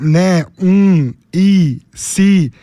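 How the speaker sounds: drawn-out hesitation fillers né, um, e, se; filler sounds demo. Shown as a demonstration. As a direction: show the filler words as a nervous speaker says them. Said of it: "Isso é um vício de linguagem".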